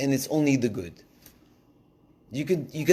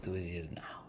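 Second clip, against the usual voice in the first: whisper; 6 semitones lower, 14 dB quieter than usual. Real, not acted. Unvoiced whispered phrase.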